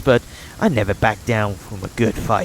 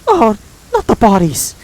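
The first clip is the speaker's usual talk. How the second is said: mock horror; 11 semitones higher, 7 dB louder than usual. Real, not acted. Player reacts with mock horror.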